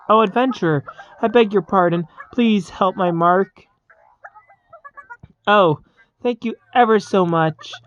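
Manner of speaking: lightly voiced polite villager; a hint of a grateful woman; pleading townsperson. Voicing a townsperson who begs help.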